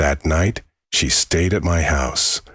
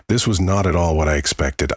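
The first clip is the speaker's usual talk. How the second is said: ordinary delivery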